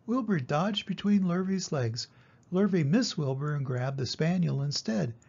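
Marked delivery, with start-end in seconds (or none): none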